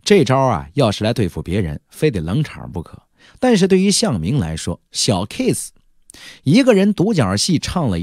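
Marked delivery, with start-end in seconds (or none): none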